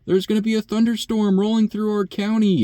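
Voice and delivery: mocking imitation of a panicked coworker; panicky coworker. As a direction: squeak it out like a frightened coworker overreacting to a storm warning. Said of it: "she comes in and she's like".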